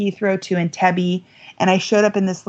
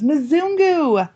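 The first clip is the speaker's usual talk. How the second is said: put-on high, drawn-out child's cry; little girl calling out; 8 semitones higher than usual. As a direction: imitate an excited child's high call.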